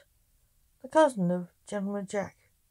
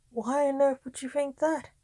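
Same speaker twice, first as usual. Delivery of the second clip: higher-pitched, baffled questioning; incredulous; 6 semitones higher than usual. A narrator's reading. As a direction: ask in sheer disbelief. King astonished at Justin's story.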